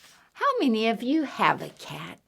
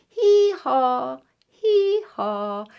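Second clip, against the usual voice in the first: put-on a playful donkey hee-haw for kids; donkey bray; 4 semitones higher, 4 dB louder, slower than usual. Asks how a donkey goes.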